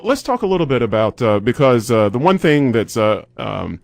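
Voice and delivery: ordinary delivery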